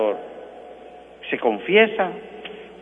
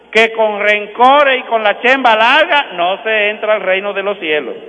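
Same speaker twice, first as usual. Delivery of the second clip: preaching at full force; 5 semitones higher, 5 dB louder than usual. Acted. Priest warning radio listeners who won't forgive, loud stern preaching. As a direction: hammer it home like a fiery preacher.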